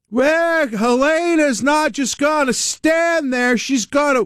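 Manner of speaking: slow, high, drawn-out mock politician; Patrick Kennedy impression. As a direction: ham up a rambling politician's stump speech. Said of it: "he comes out with gems like".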